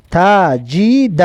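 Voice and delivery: very slow, drawn-out syllables; slow teacher's model reading. Shown as a demonstration. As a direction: stretch each syllable for learners to copy. Teacher models the joined word.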